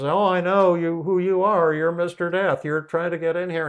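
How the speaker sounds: high, loud acted accusation; alarmed shouting. Old woman yells at Mister Death forcing the door, alarmed shout. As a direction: cry out as if fending off an intruder.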